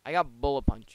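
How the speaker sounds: ordinary delivery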